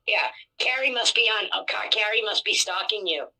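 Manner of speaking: ordinary delivery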